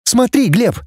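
high, sharp order; sharp, abrupt. A narrator's reading. Girl tells Глеб to look, sharply. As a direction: toss out a sharp command. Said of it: "резко обронила".

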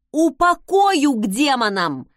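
loud, forceful threat; barked threat. A narrator's reading. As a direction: bark it out to make them back off. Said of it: "рявкнула я".

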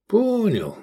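high, falling grudging answer; grudging reply. A narrator's reading. Scolded diner giving in, grudging. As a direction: give in with a sulk. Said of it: "буркнул Эдик".

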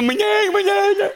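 hammed-up high whine; mock whimpering. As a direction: whimper no like a drugged child. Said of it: "Ich so".

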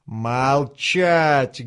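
drawn-out, restrained bark; barked order. A narrator's reading. Officer silences the protesting old man, restrained bark. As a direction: bark it as a slow, stern command. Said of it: "гаркнул тот".

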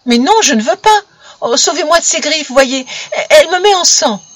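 high, loud plea for rescue; frightened child's cries. A narrator's reading. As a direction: cry out for help in distress. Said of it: "attiré par les cris d'Amy".

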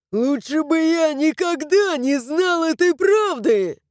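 high, strained outburst; anguished cry. A narrator's reading. Highlander wishes he never knew the truth, crying out. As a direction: cry it out, pitch soaring throughout. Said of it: "закричал он".

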